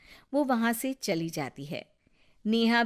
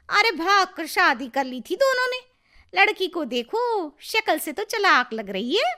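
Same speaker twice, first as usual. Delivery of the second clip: high, loud catty gossip; the husband's aunt mocking the bride at the party, high and loud; 10 semitones higher, 6 dB louder than usual. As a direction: sneer at the bride behind her back.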